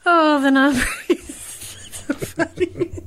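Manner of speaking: talking through helpless laughter; real laughing. Real, not acted. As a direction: laugh so hard the words slow and break up. Laughing at the clip.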